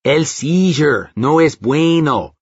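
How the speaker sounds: slow, exaggerated Mexican accent; screamed in a hammed-up Mexican accent. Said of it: "in a Mexican accent".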